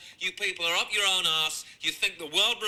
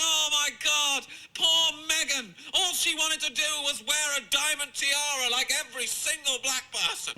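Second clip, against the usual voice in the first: mock sympathy; 7 semitones higher than usual. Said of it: "You're all sitting around going".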